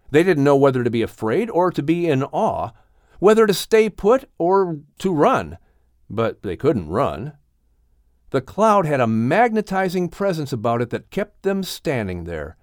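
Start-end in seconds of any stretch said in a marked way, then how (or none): none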